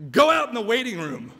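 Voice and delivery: loud, high order from a nurse; dismissive order. Acted. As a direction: send them away as an acted stern nurse.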